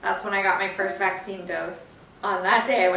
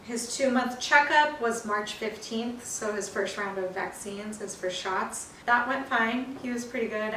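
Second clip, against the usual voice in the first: ordinary talk, nothing marked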